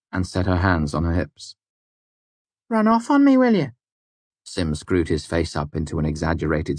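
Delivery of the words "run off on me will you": mock scolding. A narrator's reading. Hands on hips scolding.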